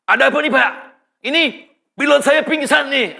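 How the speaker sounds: high, urgent retold radio plea; mock-panicked call for help; acted alarm. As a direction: act out an alarmed passenger reporting trouble.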